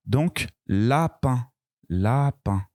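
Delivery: "lapin" said slowly, its A stretched; slow careful word. Shown as a demonstration. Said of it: "Il y a A dedans". ("lapin" lentement, en allongeant le A)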